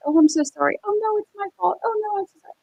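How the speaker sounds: high, sing-song fake apologizing; mock apology. Mocking attorneys faking sorry for the jury, sweetly fake. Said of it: "show that they put on where they're pretending".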